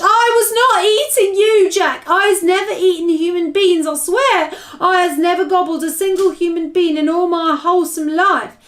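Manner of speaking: high, loud, desperate denial; screeched. A narrator's reading. Giant swearing innocence to Jack, screeched. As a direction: screech your innocence in panic. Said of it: "he screeched".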